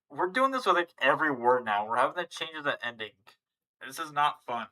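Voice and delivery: loud, exasperated rant about endings; frustrated complaint. Real, not acted. Learner venting to the Russian teacher, loud and exasperated. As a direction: vent the frustration loudly.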